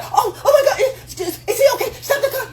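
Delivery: very high panicked falsetto through laughter; caring woman voice. Retold or imitated. Describing the woman to wife up, in a panicked high falsetto with laughter. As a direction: shriek it, laughing, like a frantic woman. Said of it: "The one that's like".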